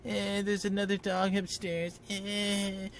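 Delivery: high, slow whining dog voice; whiny dog voice. Retold or imitated. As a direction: whine like a dog pestering its owner. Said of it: "bug me like".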